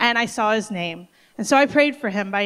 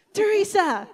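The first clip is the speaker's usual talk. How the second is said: excited call; 10 semitones higher than usual. Retold or imitated. Phil bursting in calling out to Teresa, excited high call. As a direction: call across the room with big news. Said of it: "Just like that".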